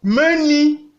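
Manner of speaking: "Main, nid" high and loud. Shown as a demonstration. Teacher reads aloud respecting tones, high "Main, nid". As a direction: lift both syllables of "Main, nid" well up. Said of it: "respectant les tons".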